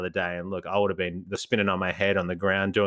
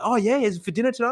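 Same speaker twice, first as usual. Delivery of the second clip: put-on high, excited questioning kid voice; eager teen; 12 semitones higher, faster than usual. Teen quizzes shopper about groceries, high and eager. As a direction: pester him about his shopping. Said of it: "He's like".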